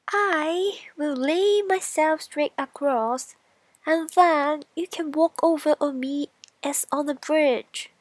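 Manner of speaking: high, loud eager straw; straw character. Acted. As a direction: offer yourself as a bridge, proudly. Straw voiced in fairy tale.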